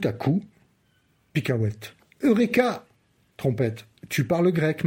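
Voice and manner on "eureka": eureka. Acted. Sudden discovery.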